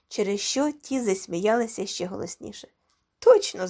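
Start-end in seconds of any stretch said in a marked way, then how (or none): 3.2-3.7 high, loud one-word confirmation; emphatic declaration. A narrator's reading. Gomez confirms they are one height, declaring it emphatically. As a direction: declare it with a sudden jump up. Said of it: "заявив він".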